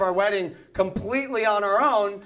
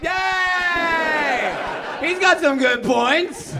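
mock cheering mother; 5 semitones higher, much slower than usual. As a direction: cheer him on gleefully. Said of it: "mother stood up like".

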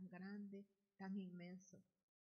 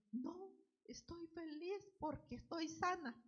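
much higher, excited quote; joyful outburst; 10 semitones higher, 4 dB louder than usual. Retold or imitated. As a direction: exclaim the joy of being healed. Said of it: "Y le dijo". (esposa explicando su llanto, alegría exclamada, muy aguda)

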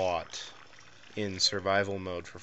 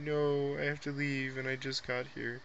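sad dweller voice; 6 semitones higher than usual. Retold or imitated. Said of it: "he's like".

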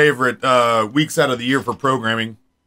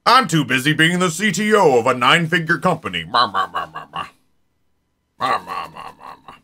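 Nate impression; 3 semitones lower than usual. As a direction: mumble into a lowered tycoon drone. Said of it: "It's a dead-on impression".

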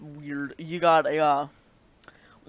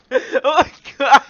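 laughing; 7 semitones higher, 11 dB louder than usual. Real, not acted. Cracking up at paper.

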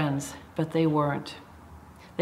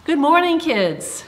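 bright greeting; 10 semitones higher, 6 dB louder than usual. Real, not acted. Greeting children at service.

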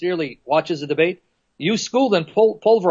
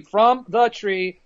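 "From the tree" spaced out deliberately; slow right down on each word; measured, pointed citation; emphatic slow quote; much slower than usual. Acted. Repeats the verse for emphasis.

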